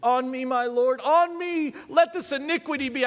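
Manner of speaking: high, pleading cry to her lord; dramatized tearful entreaty, pitch lifted high; tearful plea. Acted. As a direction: act out a woman begging through tears. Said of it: "I can just hear her with tears".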